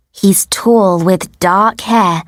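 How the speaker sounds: plain reading voice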